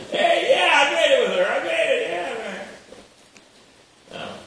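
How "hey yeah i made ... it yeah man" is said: high, loud laughing brag; yelling. Retold or imitated. The eagle boasting to the other eagles, loud and laughing. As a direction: shout the triumph through laughter. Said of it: "He's yelling to all the other eagles".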